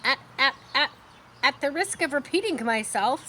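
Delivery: high, hurried goose voice; a chattering goose who stutters on the start; fast repeating goose. Acted. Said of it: "she talks fast and she kind of repeats herself".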